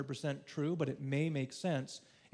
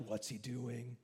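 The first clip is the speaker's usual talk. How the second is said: breathless hush; 2 semitones lower, 6 dB quieter, slower than usual. Pretend. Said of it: "holding their breath, like".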